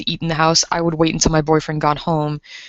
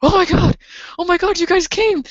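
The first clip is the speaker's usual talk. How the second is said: much higher, squealing with relief; relieved joy; 12 semitones higher than usual. Retold or imitated. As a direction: gush with relief that help has arrived. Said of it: "And I'm like".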